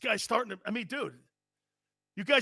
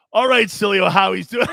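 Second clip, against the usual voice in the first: louder, half-shouted concession; mock triumphant shout; loud grudging shout; 9 dB louder than usual. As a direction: bellow it to satisfy the caller. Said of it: "You happy?".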